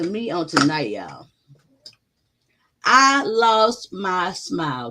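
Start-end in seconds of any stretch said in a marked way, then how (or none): 2.8-4.9 "I lost my smile" dragged out slowly; slow lament. Real, not acted. Streamer telling chat about her lost teeth, slow and heavy. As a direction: draw each word out like sad news. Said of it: "bear with me and how I'm talking".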